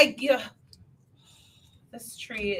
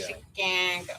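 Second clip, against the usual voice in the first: put-on Midwestern accent; 4 semitones lower, slower than usual. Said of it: "we sound very nasally".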